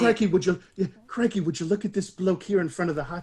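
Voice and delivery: Australian wildlife host, Crikey; Aussie croc-hunter delight; Steve Irwin Australian accent. Pretend. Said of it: "a wildlife documentary".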